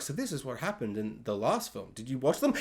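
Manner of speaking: low, quiet reporter read; news reporter. Acted. News reporter recapping the last film, imitated low and quiet. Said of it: "just have a news reporter be like".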